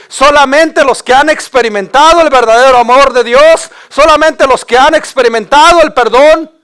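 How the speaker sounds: impassioned pulpit climax; rising preacher's cry. Real, not acted. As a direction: proclaim it with full fervor.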